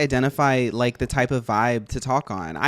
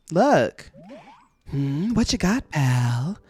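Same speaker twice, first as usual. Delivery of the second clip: chipper cartoon critter voice, higher; character voice; 4 semitones higher than usual. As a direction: voice a laid-back game creature. Reading Scyther's game dialogue.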